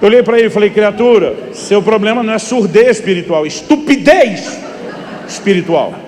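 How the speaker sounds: loud, high preacher's rebuke; preaching at full force. Real, not acted. Pastor rebuking the sleepy man before the congregation, preaching loudly. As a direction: hammer the point home to the church.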